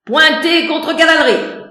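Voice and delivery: high, loud barked command; drill-sergeant order; military. Acted. (ton militaire)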